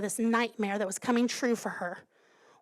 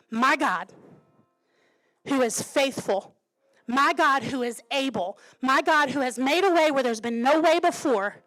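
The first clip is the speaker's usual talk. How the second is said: louder, lifted pulpit proclamation; impassioned preaching; 4 semitones higher, 5 dB louder than usual. Real, not acted. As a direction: build to a ringing declaration of faith. Preaches at full force.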